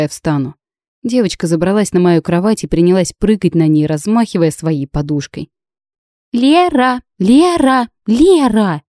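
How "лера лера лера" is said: put-on high, insistent little girl's call; child calling. A narrator's reading. Little girl jumping on the bed, calling insistently. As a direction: keep calling her name like a child.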